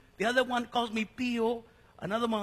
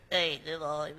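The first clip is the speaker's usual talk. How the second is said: low imitation, unlike his own voice; put-on colleague voice; 6 semitones lower than usual. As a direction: imitate him in a lowered, put-on voice. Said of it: "He doesn't talk like that".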